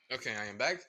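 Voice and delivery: ordinary delivery, nothing marked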